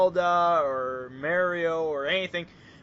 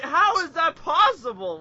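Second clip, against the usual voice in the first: incredulous outburst; 9 semitones higher, 6 dB louder than usual. Retold or imitated. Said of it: "'Cause I'm like".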